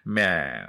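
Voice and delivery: "man" with a long flattened "æ"; low, drawn-out "man" with squashed vowel; squashed American A. Shown as a demonstration. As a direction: stretch "man" like "myaan". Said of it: "ちょっと潰れたような".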